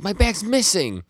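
high-pitched, drawn-out mock alarm; retold panic. Hinchike tells Shin his bag is missing, mock panic. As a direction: wail it in exaggerated dismay.

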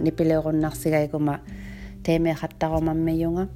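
ordinary delivery

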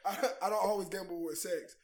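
low, hushed commercial spokesman; Dos Equis ad parody. Retold or imitated. Mocking Carter to the guys, low commercial voice. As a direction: drop it low like a suave beer pitchman. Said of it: "Carter be on the Dos Equis commercial".

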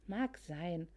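ordinary delivery, nothing marked

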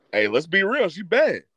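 ordinary talk

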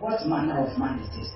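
low hushed wonder; awed onlookers. Acted. People amazed at a believer, hushed and low. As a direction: wonder under your breath. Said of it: "they will ask".

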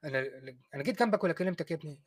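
put-on quiet, quick, baffled old scholar; mock baffled scientist. Scientist voiced denying Craig.

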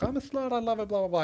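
high-pitched mock female quote; mocked woman. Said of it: "like she was like".

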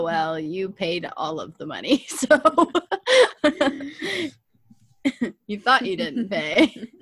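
ordinary talk, nothing marked

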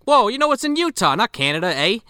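high, hammed-up Canadian "eh" voice; mock Canadian accent. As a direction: play a comic surprised Canadian. Acted Canadian stereotype joke with "eh.

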